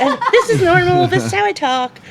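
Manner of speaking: mock loud talking. Woman defending her loudness to partner, loud self-parody. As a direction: protest loudly and laughingly that it's normal. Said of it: "I'm always loud".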